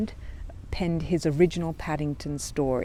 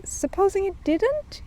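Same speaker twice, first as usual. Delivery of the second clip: much higher, slow doubtful bear voice; doubtful Paddington; slower than usual. A narrator's reading. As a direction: ask it doubtfully.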